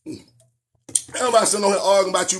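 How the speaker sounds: usual speaking voice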